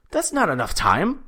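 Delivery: high mock complaint, swooping up at the end; indignant protest. Imitating Biz complaining about the clock, indignant. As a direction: imitate someone disbelieving and put out.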